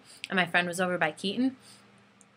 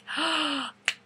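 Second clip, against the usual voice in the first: high, sharp acted gasp; starstruck gasp; 6 semitones higher, 4 dB quieter than usual. Fan when the singer waves at her, thrilled gasp.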